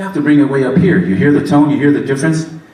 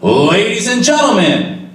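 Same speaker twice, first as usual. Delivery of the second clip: drawn-out announcer call, high but controlled; 6 semitones higher, slower than usual. Shown as a demonstration. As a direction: project it and let the mic work. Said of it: "You kind of work this microphone".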